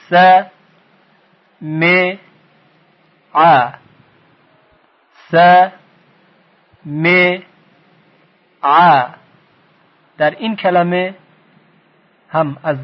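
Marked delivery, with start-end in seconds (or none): 0.1-9.1 "س، م، ع" as "sa, me, 'a", long pauses between; short fatha, kasra, fatha syllables, each standing alone; split into syllables. Shown as a demonstration. Teacher breaks up the next word, syllable by syllable. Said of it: "صدای کوتاه فتحه استفاده شده".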